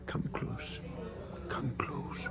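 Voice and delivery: hushed, soft whisper; secret whisper. Acted. The little man beckoning Ranald closer, whispered. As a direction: draw him in with a whisper.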